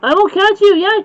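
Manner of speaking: lightly voiced angry wolf; growled. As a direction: threaten the pigs in a storybook way. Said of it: "growled the furious wolf".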